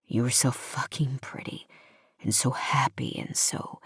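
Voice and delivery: low, hushed admiration; tender male lead. A narrator's reading. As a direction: murmur it low and soft, fading away. Hero's tender admiring confession.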